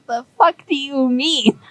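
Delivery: a hint of a shocked Zoran; stunned disbelief. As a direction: react like you just did a spit take. Zoran's spit take at Zug.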